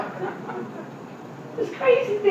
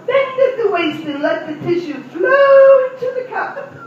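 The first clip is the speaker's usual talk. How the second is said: high, much louder saleslady voice; 10 semitones higher, 4 dB louder than usual. Retold or imitated. Saleslady at Macy's instructing a customer, in a high mock voice. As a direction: put on a high, sing-song shop assistant. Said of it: "and the lady says".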